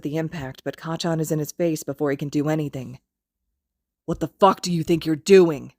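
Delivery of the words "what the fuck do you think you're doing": a louder angry hiss. Acted. Kacchan pinning Izuku to the wall, angry hiss. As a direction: lean in and hiss it. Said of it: "he hisses".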